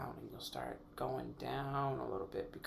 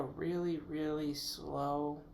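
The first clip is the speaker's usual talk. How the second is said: drawn out slowly; 2 semitones higher, slower than usual. Shown as a demonstration. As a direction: stretch "slow" out very slowly. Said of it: "almost silent inhale".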